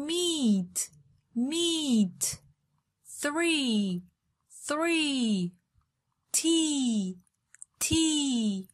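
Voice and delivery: "tree", "Meat", "Three", "Tea", "Please" with a long, drawn-out ee; teacher's repeat-after-me ee in "tree", "Meat", "Three", "Tea", "Please"; modelled "ee" vowel. Shown as a demonstration. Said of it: "صدای بعدی".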